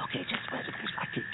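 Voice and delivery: whispered wait; unvoiced, hold her back; hiding near the dogs, whispering. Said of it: "I'm ducking low".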